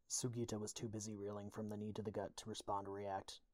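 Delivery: ordinary delivery, nothing marked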